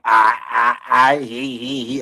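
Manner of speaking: loud mock stammer of the YouTuber; mocking stutter. Viewer mocks the YouTuber's fake stutter, loud. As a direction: bark out a fake stammer to ridicule it. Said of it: "Stumbling over words. Oh, pseudo stutter".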